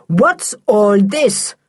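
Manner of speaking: slow, loud booming demand; booming angry stepfather; thundering. A narrator's reading. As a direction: thunder it at them. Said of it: "thundered Sooty's stepfather".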